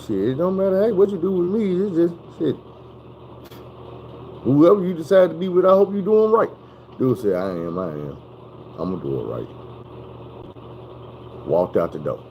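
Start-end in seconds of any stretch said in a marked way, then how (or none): 0.1-6.5 a hint of mama. Mama's dismissive reply voiced. 7.3-9.5 mumbled promise. Retold or imitated.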